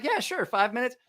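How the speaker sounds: mock casual agreement. Agreeing to watch table.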